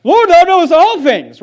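high, loud exasperated reply; mock exasperation. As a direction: act fed up with the question. Acts Peter's exasperated reply when asked thrice.

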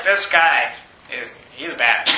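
low, slow verdict on a man; lightly voiced suspicious bystander, pitched right down; judging onlookers. Preacher voicing people who watched Paul get bitten, low judging murmur.

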